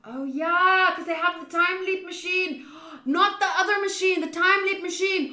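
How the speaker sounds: breathless eager realization; excited realization. Real, not acted. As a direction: blurt out the answer you just got. Excited realization about the machine.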